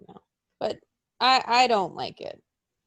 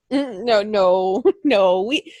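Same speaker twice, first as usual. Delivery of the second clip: ordinary talk, nothing marked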